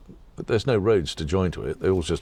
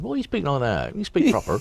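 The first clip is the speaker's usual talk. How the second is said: high, scoffing voice of a commoner, much higher on the second line; mock visitor to the queen; 8 semitones higher than usual. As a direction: sneer at the queen's accent, pitched right up. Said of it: "if you walked in and said".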